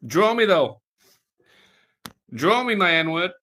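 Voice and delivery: loud, high, hammed-up demand; loud jokey plea to the viewer who draws; mock demand. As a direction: shout the request like a pushy fan. Mock demand to chat artist.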